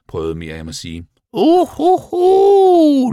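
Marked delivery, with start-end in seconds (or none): 1.3-3.1 very high, drawn-out owl cry; hoarse owl hoot. A narrator's reading. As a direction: hoot loudly like an owl, stretching the last note. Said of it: "lød det hæst fra uglen Muriel". (hæs, høj ugletuden)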